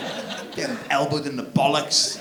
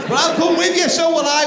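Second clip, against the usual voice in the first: put-on high kid voices; 7 dB louder than usual. Kids voiced.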